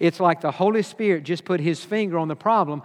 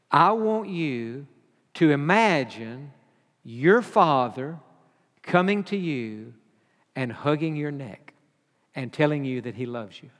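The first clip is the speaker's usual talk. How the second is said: soft guiding voice; 4 semitones lower, 4 dB quieter than usual. Retold or imitated. Counselor guides imagination softly.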